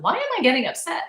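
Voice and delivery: quick, high, exasperated self-question; puzzled at her own irritation; baffled frustration. Retold or imitated. Venting to herself at work, fast and exasperated. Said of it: "I'm like".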